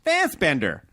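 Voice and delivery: "Fassbender" with a flat a as in "fast" and a sounded final r; American-style "Fassbender", short a, hard r; imagined American pronunciation. Acted. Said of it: "I would imagine Americans are like".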